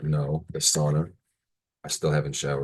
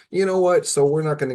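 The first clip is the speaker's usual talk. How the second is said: sudden high "You know what?"; high exclamation; 12 semitones higher, 5 dB louder than usual. Real, not acted. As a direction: pop up high and bright. Sudden upbeat turn.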